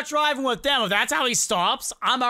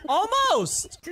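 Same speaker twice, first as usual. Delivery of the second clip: high, stretched almost; excited near-miss cry; 7 semitones higher than usual. Real, not acted. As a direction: cry it out as it nearly works.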